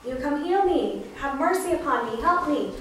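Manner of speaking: shouting out. Retold or imitated. Blind Bartimaeus calling to Jesus for mercy, shouting out. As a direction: call out pleadingly for help. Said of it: "he shouted out to Jesus".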